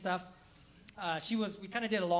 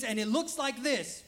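usual speaking voice

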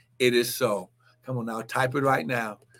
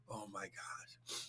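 breathless hushed whisper, much softer and lower; overcome whisper; 11 semitones lower, 25 dB quieter, slower than usual. Real, not acted. Preacher overwhelmed mid-sermon, whispering in awe. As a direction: let it fall away to a whisper.

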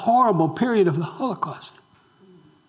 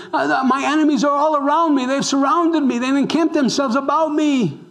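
lightly voiced Israel crying out, higher; mock cry of Israel; 6 semitones higher than usual. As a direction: plead like a besieged people, lightly acted. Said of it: "This is Israel crying out saying".

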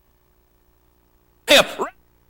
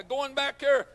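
usual speaking voice

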